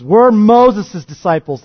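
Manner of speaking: loud, slow, proud protest; indignant retort. Acted. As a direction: throw the name of Moses at him. Pharisees reviling the man.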